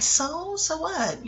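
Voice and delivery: high, lighter-voiced mock nonchalance; dismissive shrug. Imitating a casting agent's shrug to a colleague, dismissive.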